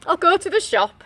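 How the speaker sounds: decide it firmly; lightly voiced wolf deciding; determined wolf. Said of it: "he decided".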